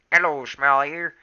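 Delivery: slow polite hello; respectful greeting. A narrator's reading. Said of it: "mewed Graypaw, nodding respectfully".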